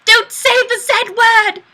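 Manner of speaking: loud, high yelled scolding; mock outburst. Streamer yelling at a viewer in mock outrage. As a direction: yell it in fake outrage.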